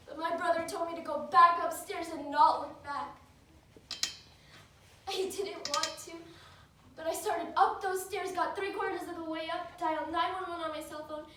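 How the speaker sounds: tense, breathless-paced witness account; urgent recounting. Acted. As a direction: push through the events in rising urgency. Acted monologue, recounting escape upstairs.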